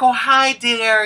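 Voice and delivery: put-on high, sugary greeting of an older lady; sweet old lady. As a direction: coo it like a kindly older shopkeeper. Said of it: "she's like".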